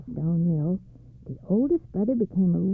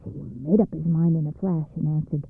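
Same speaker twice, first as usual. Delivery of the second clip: ordinary delivery, nothing marked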